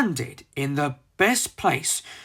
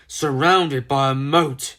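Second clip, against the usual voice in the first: ordinary delivery, nothing marked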